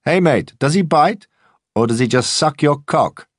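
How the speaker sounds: loud mocking yob voice; jeering thug. A narrator's reading. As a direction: goad the man into a fight. Weightlifter taunting the owner.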